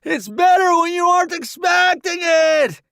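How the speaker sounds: high, loud shout while running. Acted. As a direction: yell back over the shoulder. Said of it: "he shouts over his shoulder".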